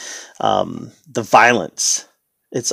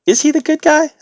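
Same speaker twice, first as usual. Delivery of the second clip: much higher, squeaky put-on questioning; mock doubting question; 4 dB louder than usual. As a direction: ask in a comically high voice. Mock doubting question about Bugs.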